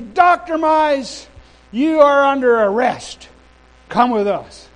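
stern police order. Retold or imitated. Police officers confronting the visiting minister, sternly ordering. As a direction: announce an arrest with slow, loud authority. Police arresting him in retold story.

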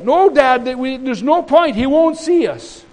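high, loud refusal; acted protest. Sons telling Dad there is no point, acted protest. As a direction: plead the point forcefully as a son.

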